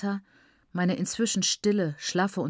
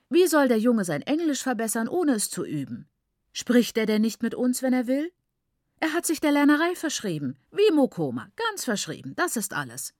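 father's reassurance; 6 semitones higher than usual. Acted. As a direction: brush off her worry with a firm, raised voice. Father calms worried mother.

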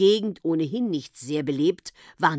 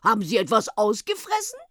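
quick, higher-pitched question; quick call after; 6 semitones higher, 4 dB louder, faster than usual. A narrator's reading. Henriette hurriedly calling after the departing gendarme. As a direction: toss the question after him fast. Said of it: "rief Henriette schnell noch hinterher".

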